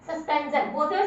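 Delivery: ordinary delivery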